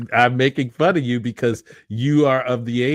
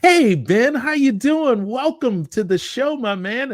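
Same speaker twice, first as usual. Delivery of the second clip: high bright host greeting; excited host; 13 semitones higher than usual. Acted. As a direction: welcome him like a game show host. Upbeat show host greeting.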